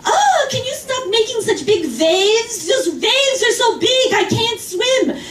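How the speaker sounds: put-on high, slow, whining old-lady complaint; whiny old lady. To the swimmer across the pool, a high, slow whining complaint. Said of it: "she starts yelling at me across the pool".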